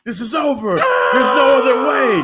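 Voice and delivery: hammed-up yell of no other way; desperate shout. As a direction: shout it like a wrestler pleading. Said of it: "And he's like".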